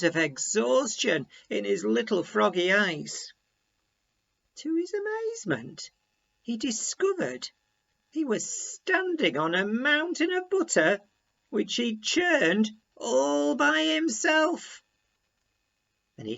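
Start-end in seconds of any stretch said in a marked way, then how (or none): 4.6-14.8 amazed wonder. A narrator's reading. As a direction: marvel at the discovery. Surprise ending.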